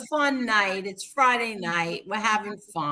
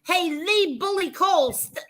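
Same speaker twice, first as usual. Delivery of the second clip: higher, half-laughing mock scold of Lee; mock scolding call; 8 semitones higher than usual. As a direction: scold him in fun, pitched up. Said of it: "'cause I called you".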